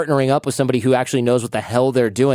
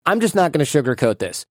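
ordinary delivery

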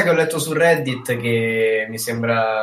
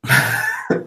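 sudden high burst of laughter. Real, not acted. Laughs at the joke. (risata acuta improvvisa)

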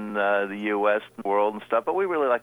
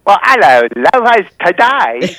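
hammed-up Cockney hello, loud and higher; mock Cockney greeting; 6 semitones higher, 13 dB louder than usual. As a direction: tease her with a cheeky Cockney "love". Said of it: "I do not speak the Cockney a-, dialect".

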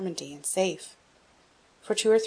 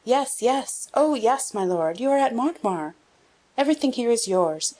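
eager delight; 6 semitones higher, 3 dB louder than usual. A narrator's reading. Friend eagerly reassures returned boy.

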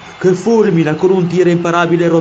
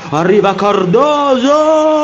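goal-call shout; 11 semitones higher, 4 dB louder, much slower than usual. Acted. Commentator calls a shot.

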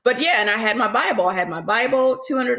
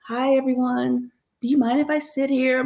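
shy; 4 semitones higher than usual. Retold or imitated. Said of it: "kinda shy".

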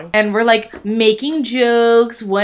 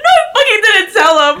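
laughing frantic admission; 11 semitones higher, 6 dB louder, faster than usual. Retold or imitated. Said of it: "And I was like".

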